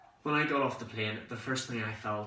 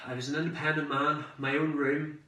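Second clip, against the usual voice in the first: ordinary delivery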